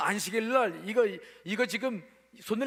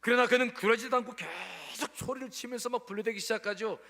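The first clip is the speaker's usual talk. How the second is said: ordinary delivery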